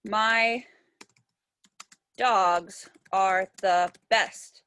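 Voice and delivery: very slow, long pauses between words; dictating while writing. Shown as a demonstration. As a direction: write it out aloud word by word. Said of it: "I had for my first line".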